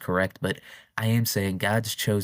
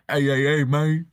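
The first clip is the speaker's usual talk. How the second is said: loud, higher hammed-up greeting; a loud, higher joke take on a deep-voiced star; mock deep-voiced Michael; 7 semitones higher, 5 dB louder than usual. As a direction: bellow a fake tough hello, louder and higher. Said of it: "I heard he had a really deep voice".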